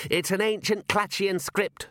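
high-pitched, slow, earnest explainer; Constable Visit. Acted. Constable explaining the writing to his commander, high and earnest. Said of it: "said Constable Visit".

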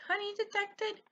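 high, evenly repeated catchphrase voice; imitated video voice. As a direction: mimic a high-pitched cartoon announcement.

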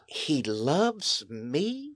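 slow, soft, wondering question; hushed astonished He loves me; amazed wonder. Retold or imitated. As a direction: let it sink in with disbelief. Voicing the amazed girl.

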